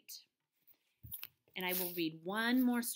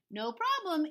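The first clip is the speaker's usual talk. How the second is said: higher louder cheerful farmer; relaxed farmer reply; friendly farmer; easygoing farmer; 9 semitones higher, 8 dB louder than usual. A narrator's reading. Farmer Dale answers.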